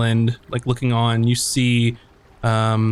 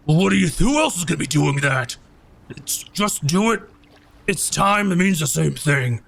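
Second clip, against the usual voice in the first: Gato the bugbear; 3 semitones higher than usual. Acted. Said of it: "um, Gato goes".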